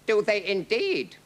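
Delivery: high, affronted retort; livid. A narrator's reading. King answering the captain's threat, livid. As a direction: retort in royal rage. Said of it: "now livid with rage".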